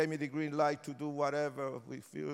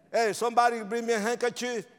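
higher, louder call across the room; 8 semitones higher, 9 dB louder than usual. Real, not acted. Preacher calling to the church helpers for a handkerchief, loud call. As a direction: call out to someone at the back.

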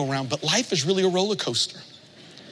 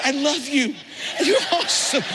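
excited gushing; 9 semitones higher than usual. Retold or imitated. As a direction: gush with delight at the satnav. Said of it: "I said".

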